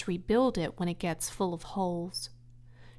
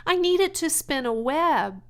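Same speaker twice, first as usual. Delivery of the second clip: high, loud eager piglet; eager pig asking; 8 semitones higher, 6 dB louder than usual. Acted. Wilbur asking Templeton for string, eager. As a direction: ask the favor brightly.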